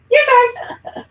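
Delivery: squealing high laugh, loud your turn; laughing handoff. Real, not acted. Host laughs loudly handing the turn to her cohost. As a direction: burst out laughing as you pass the mic.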